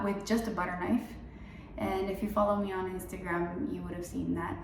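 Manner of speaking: ordinary talk, nothing marked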